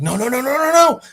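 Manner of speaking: rising string of panicked protests; acted freak-out, building. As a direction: imitate mounting panic, pitch climbing high. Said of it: "starts freaking out, freaking out hard".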